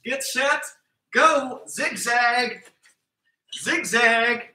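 high, bouncy workout calls; hyped coach calls. Acted. Coach hyping kids through zigzags and push-ups, high and bouncy. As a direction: pump up the kids through the drills.

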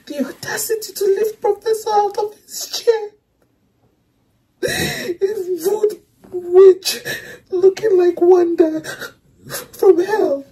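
high, tearful, half-laughing outrage; indignant laughter while crying; laughing through tears. Real, not acted. Fan outraged at the villain, laughing and crying. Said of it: "I'm so mad".

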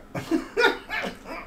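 airy high-pitched burst of laughter; unvoiced giggle; high breathless laugh. Real, not acted. Laughs at own word.

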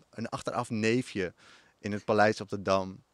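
ordinary talk, nothing marked

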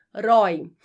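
roi said alone, loud and firm; stressed syllable. Shown as a demonstration. Teacher marking the stressed syllable, loud and firm. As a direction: punch out roi as the strong syllable. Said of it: "a penúltima sílaba mais forte".

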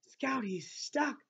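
put-on frightened boy breathing the words to his sister; breathed. A narrator's reading. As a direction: barely breathe it out in fear. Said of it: "breathed Jem".

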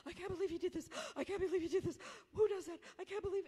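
high, gasping mock sobs between phrases; imitated sobbing. Stranded woman sobbing to a stranger in disbelief, imitated sobbing. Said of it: "she's sobbing, and she's saying".

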